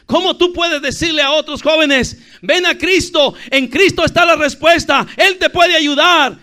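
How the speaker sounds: high, loud pulpit cry; full-force preaching. Real, not acted. Preacher urging youths to call others to Christ, full-force preaching.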